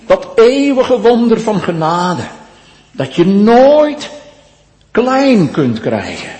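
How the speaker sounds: preacher rejoicing over grace; full-force preaching. Real, not acted. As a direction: proclaim grace with fervor.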